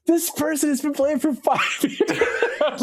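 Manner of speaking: high, slow mock protest breaking into laughter; incredulous, giggly complaint; laughing exasperation. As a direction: retell disbelief, cracking up at the end. Retold exasperated reply to wife.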